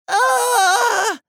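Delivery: very high, loud startled scream. A narrator's reading. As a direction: shriek in shock. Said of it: "Andy screamed too".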